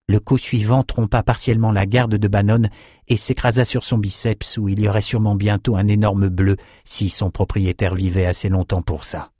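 plain reading voice

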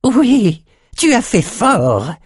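very high, slow, gleeful voice; jubilant. A narrator's reading. André delights in Nathan's disaster, high drawn-out jubilation. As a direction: relish it with a high, stretched-out crow. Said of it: "jubila André".

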